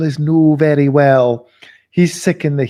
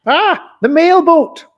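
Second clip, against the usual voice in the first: put-on much higher, louder cry of an old man; elderly man's voice; 5 dB louder, slower than usual. Old uncle greeting visitors as the mailboat, in a high excited old voice. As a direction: call out delighted from the next room. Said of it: "the voice of an elderly man from within".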